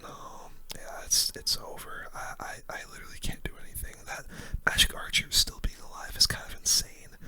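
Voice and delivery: usual speaking voice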